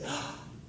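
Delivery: sharp gasp of realization; quick acted gasp; sudden gasp. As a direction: gasp as if it all clicks. Imagined listener's sudden realization.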